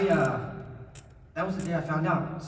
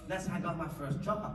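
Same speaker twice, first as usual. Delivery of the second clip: normal talking voice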